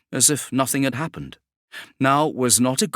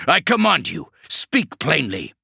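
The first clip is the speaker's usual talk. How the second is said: commanding shout; 7 semitones higher, 4 dB louder than usual. Acted. Kravarek commands the demon, loud. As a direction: order him with all your authority.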